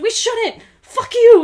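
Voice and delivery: high, loud mock yell of fury; hammed-up angry shout; mock furious yell. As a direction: yell it back at him, laughing. Retelling Mulan rejecting him.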